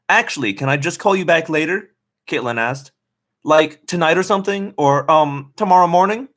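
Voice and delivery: put-on high breezy teen girl; lightly voiced bubbly friend. A narrator's reading. Friend brushing her off.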